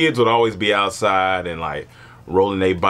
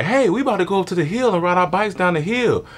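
put-on kids' invitation; 10 semitones higher, faster than usual. Kids inviting to the hill.

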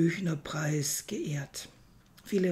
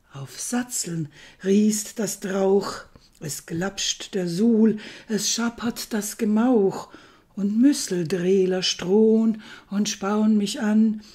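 poem recitation; 2 semitones higher, 6 dB louder than usual. Acted. Presenter performs a parody of Goethe for listeners, declaimed poem. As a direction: declaim nonsense verse with full theatrical force.

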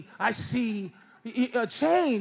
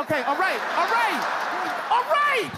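panicked stalling; 7 semitones higher than usual. Retold or imitated. Rising panic.

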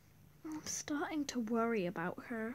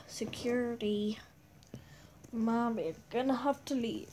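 ordinary talk

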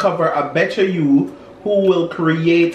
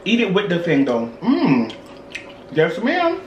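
talking while chewing food. Real, not acted. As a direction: mouth partly full. Seen 2: Left man bit pepper at -4, mouth working during words.

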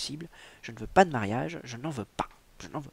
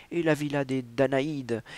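ordinary delivery

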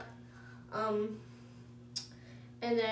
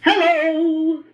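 loud, higher-pitched hello; much louder; 4 semitones higher, 11 dB louder than usual. Shown as a demonstration. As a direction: call it out big and loud. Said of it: "I'm about to get a lot more loud".

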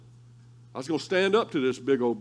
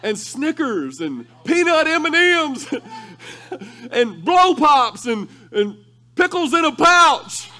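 excited; 11 semitones higher, 10 dB louder, slower than usual. Retold or imitated. Excited list of snacks.

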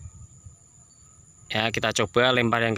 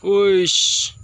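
high, loud call to the bird; sharp call; 7 semitones higher, 6 dB louder than usual. Real, not acted. Owner calling pigeon down, loud high call. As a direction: snap the call out.

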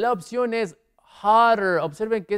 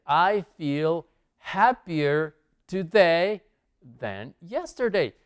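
slow model reading; slower than usual. Shown as a demonstration. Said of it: "teacher models the English sentence".

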